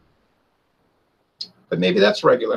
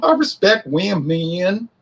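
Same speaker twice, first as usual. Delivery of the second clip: much higher, slow simpering apology; whiny fake apology; 10 semitones higher, slower than usual. Pundit mocking apologizing Republicans in a high whine. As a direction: grovel in a high, drawn-out whine. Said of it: "They get on there talking about".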